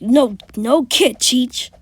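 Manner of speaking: loud, dismissive "no, no"; smug correction. Retold or imitated. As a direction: wave the teacher off loudly. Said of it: "And he would be like".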